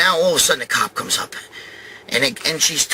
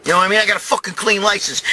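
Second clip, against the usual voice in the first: normal talking voice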